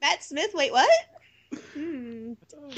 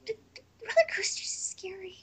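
soft, higher, whispered and tearful; timid Fluttershy; 7 semitones higher, 9 dB quieter than usual. Retold or imitated. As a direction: shrink away in a scared near-whisper. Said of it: "Fluttershy would be like".

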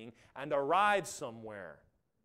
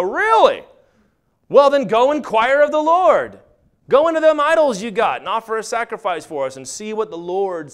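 loud, high, hammed-up eagerness; hammed-up excitement; 7 semitones higher, 16 dB louder than usual. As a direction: act out their eager demand at full volume. Danites excitedly ordering the priest.

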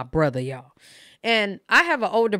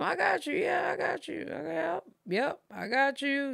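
dismissive I got you; 9 semitones higher, 5 dB quieter than usual. Retold or imitated. Brushing off mother's requests.

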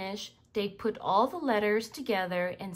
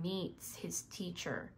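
whispered aside in the story; 6 semitones lower, 12 dB quieter than usual. A narrator's reading. Narrator reading to children, whispered. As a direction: breathe the words without voice.

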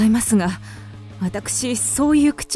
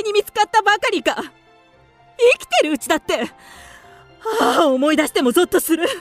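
high, agitated bitterness; indignant outburst; 5 semitones higher than usual. Acted. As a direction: pour out the betrayal with rising indignation. Widow recalls husband's betrayal, indignant.

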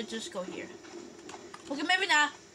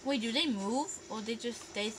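normal talking voice

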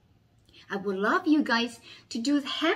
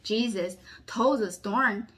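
dramatic storytelling. A narrator's reading. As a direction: lively voice for a children's Bible story.

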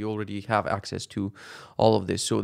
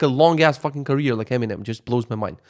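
ordinary talk, nothing marked

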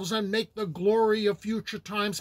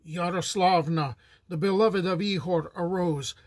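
plain reading voice

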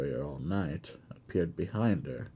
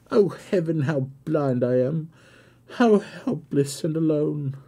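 high, loud, desperate lament; anguished outcry; 10 semitones higher, 7 dB louder than usual. A narrator's reading. Blind daughter reproaching her father in despair, anguished and loud. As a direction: cry out as if your world is torn away. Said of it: "almost beyond endurance".

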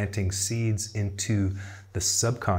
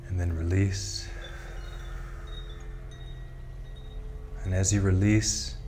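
guided meditation; 4 dB quieter than usual. Acted. Tarot guide leading listeners into breathing and imagery, calm guided meditation. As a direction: ease them gently down into stillness.

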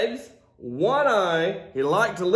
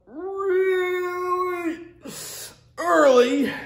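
slow stretched emphasis; 9 semitones higher, much slower than usual. Acted. Stretched out "really" for early.

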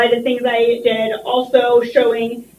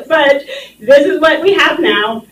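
teary; 4 semitones higher, 4 dB louder than usual. Real, not acted. Emotional plea to the commission.

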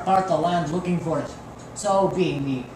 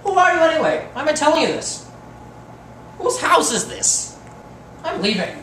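indignant; 9 semitones higher than usual. Acted. Indignant departure.

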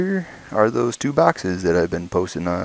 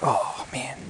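excited whisper at the box; 4 semitones higher, 6 dB quieter than usual. Real, not acted. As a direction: breathe it out in awe. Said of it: "I'm talking a little quiet".

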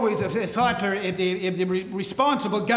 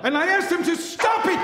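higher indignant shout; 7 semitones higher than usual. Acted. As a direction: yell it in outrage. Politician ranting about London.

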